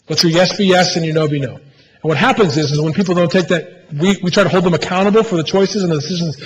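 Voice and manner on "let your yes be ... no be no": normal talking voice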